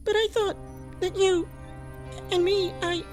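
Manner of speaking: high voice faltering between pauses; small, halting disappointment; hurt, hesitant. Acted. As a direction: start to confess, then lose your nerve. Woman hurt by Bubba's refusal.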